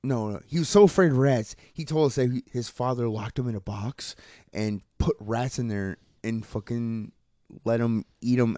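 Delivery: ordinary talk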